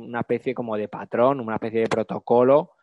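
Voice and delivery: ordinary talk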